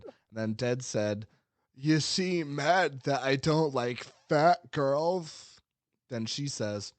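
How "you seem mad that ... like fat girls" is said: very slow, raised, smarmy creep voice; a hint of a condescending online creep; sweaty incel voice. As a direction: drag it out like a smug incel. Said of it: "sweaty incel voice".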